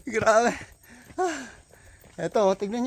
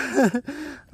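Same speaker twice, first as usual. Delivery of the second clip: short high laugh; 7 semitones higher than usual. Real, not acted. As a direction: burst into a brief, delighted laugh. Amazed by the view. (maikling mataas na tawa)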